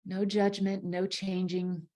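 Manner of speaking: guided meditation. Acted. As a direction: ease them into their breath, unhurried.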